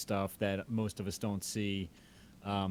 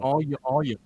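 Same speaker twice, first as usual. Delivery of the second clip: Southern demo; 5 semitones higher, 8 dB louder than usual. Shown as a demonstration. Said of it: "in Georgia".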